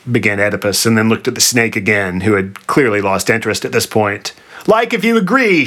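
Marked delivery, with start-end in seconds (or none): 4.6-5.6 high, insistent shout; raised, demanding cry. A narrator's reading. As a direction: shout it, pitch jumping way up. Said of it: "shouted the Sphinx".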